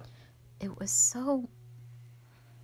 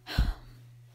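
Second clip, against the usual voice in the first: loud rush of breath; heavy sigh; 7 dB louder than usual. Acted. Dragon defending humanity, heavy sigh. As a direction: sigh out hard.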